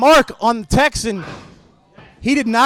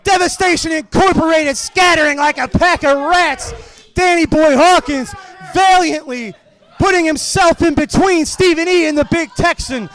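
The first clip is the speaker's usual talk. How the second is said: raised, urgent ringside call; wrestling announcer yelling over the chaos; excited play-by-play; 5 semitones higher than usual. Acted. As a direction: call the brawl like it's live and chaotic. Live play-by-play of ringside brawl.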